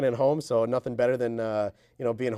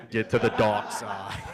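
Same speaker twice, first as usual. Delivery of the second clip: Vader bit; 7 semitones lower, slower than usual. Pretend.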